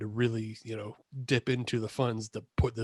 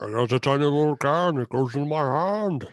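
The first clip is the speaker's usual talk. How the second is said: put-on French-accented giant; 2 semitones higher, 4 dB louder than usual. Joking to friends about a tiny can, hammed-up French accent. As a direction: play a hulking giant with a mock French accent. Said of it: "best French accent I've ever heard you do".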